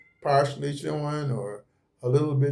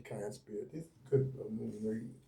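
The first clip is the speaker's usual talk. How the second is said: hushed aside to spirit; 4 semitones lower, 15 dB quieter than usual. Real, not acted. Thanking a spirit during reading.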